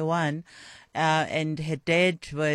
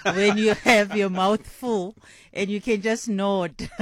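laughing; 2 semitones higher, 5 dB louder than usual. Real, not acted. Laughing about mouthful moment.

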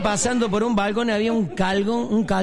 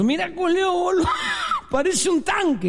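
put-on high, hollered drunk's remark; amazed exclamation; 12 semitones higher than usual. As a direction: call it out high in mock amazement. Drunk exclaims at passing woman. (exclamación aguda de borracho asombrado)

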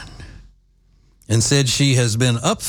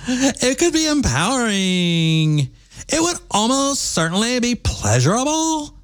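put-on young woman voice; 7 semitones higher than usual. Shown as a demonstration. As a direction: keep playing the gushing young woman. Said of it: "the typical young woman voice like this".

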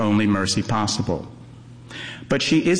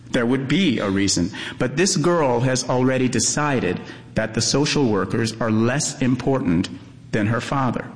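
ordinary delivery

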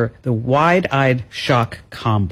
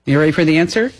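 normal talking voice

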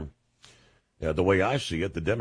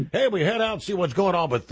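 upbeat radio host; 10 semitones higher, 4 dB louder, faster than usual. Real, not acted. Host lifts into next call.

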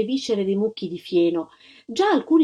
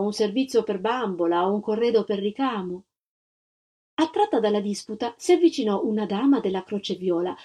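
ordinary delivery

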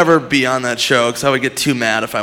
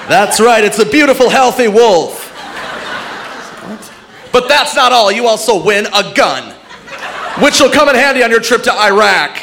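mock announcer; 8 semitones higher, 3 dB louder than usual. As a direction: announce the prize like a showman, much higher. Announcer continues prizes.

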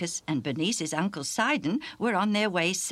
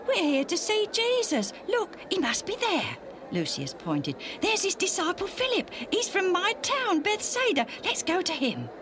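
very high, excited voice; Lucius eager. A narrator's reading. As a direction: point ahead eagerly. Lucius eager to see Jesus.

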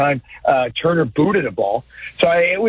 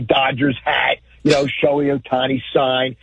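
ordinary talk, nothing marked

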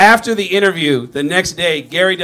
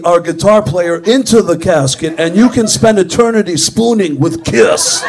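mock sales announcement; 6 dB louder than usual. Heckler cutting into the interview, loud mock sales pitch. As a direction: interrupt with a big mocking announcement. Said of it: "I kept jumping in the middle of his interview".

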